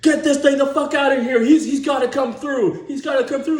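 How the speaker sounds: put-on old cop roaring to clear the road; crusty cop yelling. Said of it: "this old crusty cop".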